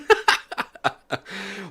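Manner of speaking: high, breathy laugh after a scare; shaky relieved laughter. Real, not acted. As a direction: laugh off being spooked. Laughing off the fright.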